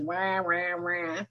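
soft, low mock droning of unheard talk; quiet cartoon-adult wah wah. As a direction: imitate voices you're tuning out. I don't hear a word they say.

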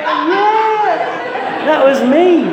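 put-on high, loud, proud little-kid voice. Husband to his wife like a little kid, high and proud. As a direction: squeal it like a kid claiming credit. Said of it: "I'm like a little kid".

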